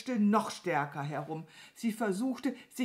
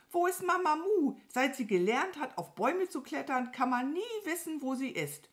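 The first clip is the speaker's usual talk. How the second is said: hohe, aufgeregte Krähenstimme; suchende Krähe; 9 semitones higher than usual. Acted. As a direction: als ratlose Krähe hoch und lebhaft sprechen. (hohe Krähenstimme, verwundert)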